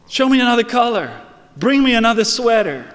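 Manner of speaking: fast, excited pleading; eager. Retold or imitated. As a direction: demand the next sweater in delight. Father eagerly asking for more.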